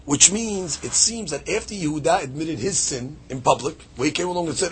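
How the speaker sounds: ordinary talk, nothing marked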